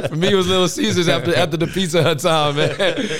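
loud, high-pitched cry through laughter; laughing protest. Real, not acted. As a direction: crack up while loudly insisting. Joking about fast food with host.

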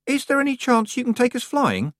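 put-on high hopeful child voice; boy pleading to fly. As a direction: ask like an eager little boy. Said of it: "the possibly older boy said hopefully".

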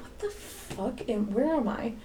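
slow, soft retold disbelief; muttered bewilderment. Teacher reacting to the girl's remark, quietly baffled. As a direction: say it under your breath, drawn out.

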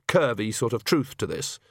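plain reading voice

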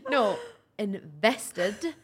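"invested" drawn out very slowly; clarifying the word. Shown as a demonstration. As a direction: correct the mishearing with a slow, laughing "invested". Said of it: "I thought you said infested".

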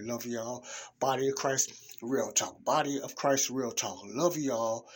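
ordinary talk, nothing marked

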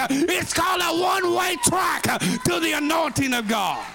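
loud, high preacher's climb; rising shouted sermon; preaching at full force. Acted. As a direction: preach it hard to the church.

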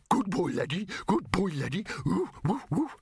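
high yappy doggy voice with woofs; talking dog. Acted. A dog praising himself and woofing, high doggy voice. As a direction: yap it out like a pleased dog.

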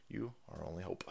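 soft breathy whisper. Acted. Thanking donor Salty with a Star Wars whispered plea.

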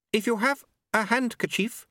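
high, careful, tactful offer; diplomatic. Acted. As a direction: tread tactfully, pitched well up. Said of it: "still diplomatically".

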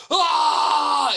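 long, high, loud battle scream; imitated war cry. Man standing on balcony furniture, screaming like Braveheart. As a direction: scream like a warrior charging. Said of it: "looking like Braveheart".

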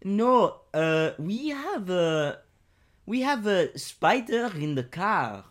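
hoog en traag Frans; French accent. Acted. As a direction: Franse moeder, langzaam en hoog. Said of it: "I'll be French".

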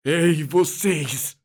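slow, high and commanding; commanding call. A narrator's reading. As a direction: call out to stop them all. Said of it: "falei imponente".

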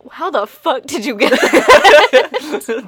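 a slow, loud, laughing shout of disbelief. Retold or imitated. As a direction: draw it out loud, like you can't believe what you heard. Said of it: "much like everyone watching, is just like".

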